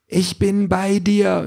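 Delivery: high, slow sustained reassurance; drawn-out digital boyfriend calling; Ken from her bag. A narrator's reading. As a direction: stretch it out in a high, distant-sounding voice. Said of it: "hört sie seine Stimme aus ihrer Tasche".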